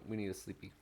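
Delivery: ordinary delivery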